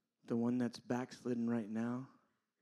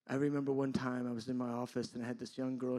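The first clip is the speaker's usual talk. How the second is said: ordinary delivery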